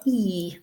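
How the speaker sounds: E held as a long vowel. Shown as a demonstration. Teacher shows students the two-dot sound, long E. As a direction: stretch out the vowel E. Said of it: "nice lengthy vowel".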